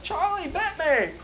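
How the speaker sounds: high, childish mock whine; Charlie bit me kid. Imitating the crying toddler from the viral video. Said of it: "that video where it's like".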